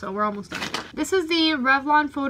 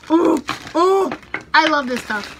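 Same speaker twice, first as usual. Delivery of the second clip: excited gush; 4 semitones higher, 8 dB louder, slower than usual. Real, not acted. Gushing over favorite lip scrub.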